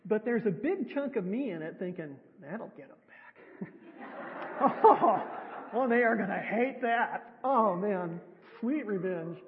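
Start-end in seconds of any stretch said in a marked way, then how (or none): 2.4-3.7 whispered, slow and sly; gloating whisper. Retold or imitated. Preacher voicing her vengeful thought, sly whisper. 3.7-9.4 laughing, much louder, delighted; gleeful laugh. Retold or imitated. Preacher relishing imagined revenge on scammer, gleeful laughing.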